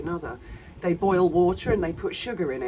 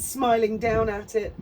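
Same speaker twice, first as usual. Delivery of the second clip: normal talking voice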